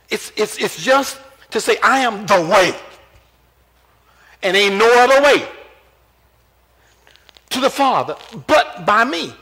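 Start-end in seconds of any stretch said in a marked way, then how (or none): 1.8-9.3 loud, driving sermon delivery; rising preacher's fervor; full force preaching. Acted. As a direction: preach it hard to the congregation. Preaching Jesus' claim loudly.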